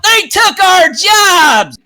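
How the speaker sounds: high, loud, drawn-out mob yell; villager shouting. Acted. Another angry villager in the mob, slow high shout. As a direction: stretch the complaint into an angry holler. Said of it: "as another shouts out".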